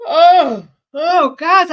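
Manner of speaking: loud high yawn through the words; words stretched out by a real yawn. Real, not acted. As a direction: yawn big on "oh" while talking. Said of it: "I don't know why I'm yawning".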